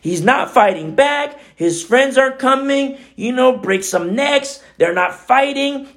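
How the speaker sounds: high-pitched indignant rant; retold anger. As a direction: rant like the angry younger self. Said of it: "And I was so mad".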